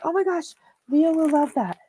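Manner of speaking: high, gushing mock fan voice; excited gush. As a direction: squeal it like a thrilled fan. Imagined viewer gushing over fabric.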